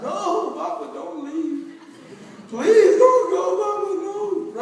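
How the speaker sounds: high, hammed-up wailing plea; mock a child's desperate cry, bursting into a shout; epic child cry. Child begging Papa not to leave for work, melodramatic wail. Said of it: "It's the most epic cry of all time".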